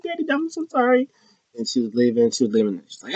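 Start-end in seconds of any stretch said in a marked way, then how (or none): 0.0-1.1 high, fast mock whine; mock whiny Liv. Liv begging Daddy Dom not to leave, high whiny plea.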